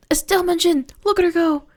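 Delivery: high, gasping fan delight; thrilled fan outburst; excited gasp. Real, not acted. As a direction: squeal it out in real delight. Fan sees favourite character named.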